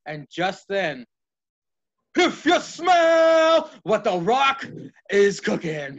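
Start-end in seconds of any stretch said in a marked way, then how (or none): 2.1-5.9 slow, high, loud wrestler catchphrase shout; The Rock impression. The wrestler interrupts Michaels' promo, big slow catchphrase shout. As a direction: boom it out like a wrestler hitting the arena. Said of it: "Here comes The Rock".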